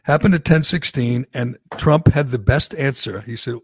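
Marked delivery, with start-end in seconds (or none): none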